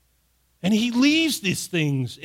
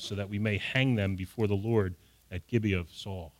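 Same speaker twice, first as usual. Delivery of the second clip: measured Bible reading, lower and calmer; scripture reading; 5 semitones lower than usual. Acted. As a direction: read the passage steadily from the pulpit. Reads scripture passage aloud.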